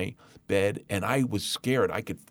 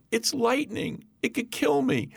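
put-on much higher, childlike frightened voice; frightened little boy; 11 semitones higher than usual. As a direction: play yourself at five, scared of lightning. Five-year-old [name] to sister.

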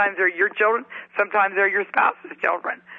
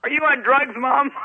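higher-pitched mock-incredulous question; shocked kid; 6 semitones higher, 3 dB louder than usual. Kids to suddenly gentle mother, mock shock. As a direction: quiz mom in comic disbelief, about to laugh. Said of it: "They must have been shocked".

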